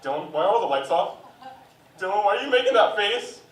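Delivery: dad finding son lost in the game, rising alarm; shocked dad. Retold or imitated. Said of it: "He comes back".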